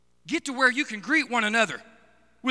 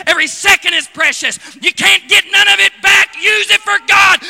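much louder, higher shouted preaching; shouting the church awake at the climax; 5 semitones higher, 14 dB louder than usual. Real, not acted. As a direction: build to a roaring call.

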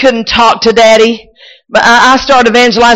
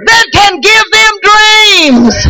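fiery preaching; 10 semitones higher, slower than usual. Real, not acted. Preacher exhorting the congregation at full force.

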